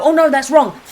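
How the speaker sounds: blurted, hammed-up self-correction; mock self-correction. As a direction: catch yourself in a mistake. Acting out correcting herself.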